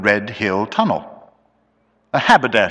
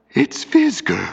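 high, choked voice; thick, stifled; 13 semitones higher than usual. A narrator's reading. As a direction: speak as through a stifling veil. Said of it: "he spoke thickly, as through some stifling veil".

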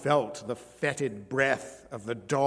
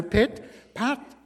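nearer footsteps; 8 semitones higher than usual. A narrator's reading. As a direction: let pit, pat arrive right at the door. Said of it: "coming faster".

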